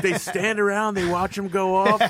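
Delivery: high puzzled-politician impression; mock bafflement, pitched well up; Trump. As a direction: marvel in a comic high voice. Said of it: "genuinely puzzled by".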